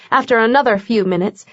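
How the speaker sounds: usual speaking voice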